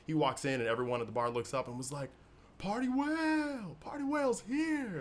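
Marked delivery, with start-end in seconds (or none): 2.6-5.0 high, slow, drawn-out cheer from a bar crowd. Acted. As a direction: hail him like a hero walking in.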